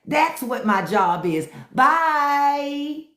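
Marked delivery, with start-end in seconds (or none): none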